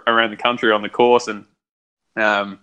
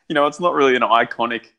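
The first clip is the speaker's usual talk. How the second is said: normal talking voice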